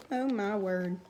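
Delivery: usual speaking voice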